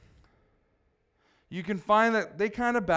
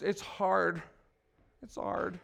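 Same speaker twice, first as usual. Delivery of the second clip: soft, mocking echo of a complaint; condescending; 8 dB quieter, slower than usual. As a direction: say it small, belittling the excuse. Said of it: "I'm being condescending when I say that".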